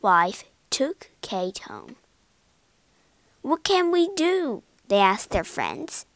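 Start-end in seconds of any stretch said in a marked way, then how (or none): 3.4-4.6 high, anxious question; worried pleading. A narrator's reading. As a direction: ask helplessly, voice lifted. Worried parents ask friends for help.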